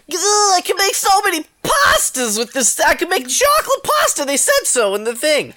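put-on high-pitched kid bursting with excitement; hammed-up, very high eager squeal of words; excited kid. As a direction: gush like an infomercial-obsessed child. Excited childhood self in retold story.

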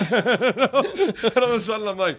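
high, slow, laughing through the words; suddenly charmed, laughing. Real, not acted.

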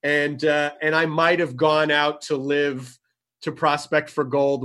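ordinary delivery

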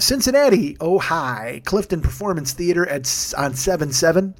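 ordinary talk, nothing marked

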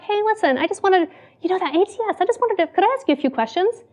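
high, sweetly polite boss voice; really polite. Retold or imitated. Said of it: "really, really polite".

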